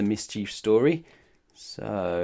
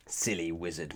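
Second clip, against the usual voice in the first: mutter; 5 semitones lower, 8 dB quieter than usual. Acted. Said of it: "muttered Mister Mischief".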